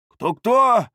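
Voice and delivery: high, loud echoed question; feigned puzzlement. A narrator's reading. Бардак pretends not to know whom they mean, feigning puzzlement. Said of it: "переспросил он, никого этим не обманув".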